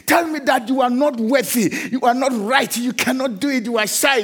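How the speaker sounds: rising, emphatic pulpit delivery; emphatic sermon cadence building to a refrain; preaching. Acted. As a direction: preach it with full conviction. Preacher listing accusations.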